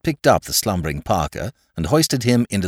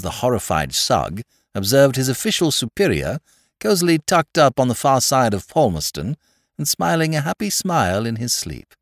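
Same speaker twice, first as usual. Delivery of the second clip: ordinary delivery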